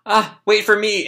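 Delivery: put-on high boy voice calling out; acted calling after. Boy running down the hall after Sebastian, calling after.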